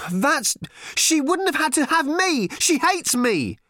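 high, loud wounded outburst; hurt teenage girl. A narrator's reading. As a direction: spit out the hurt. Random voiced, hurt.

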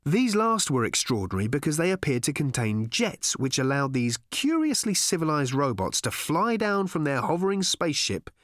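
ordinary delivery

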